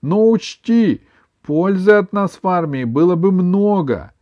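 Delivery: high, loud tearful insistence; through tears. A narrator's reading. Grandmother claiming she would be useful in army, through tears. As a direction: insist as if still crying. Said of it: "сквозь слёзы сказала она".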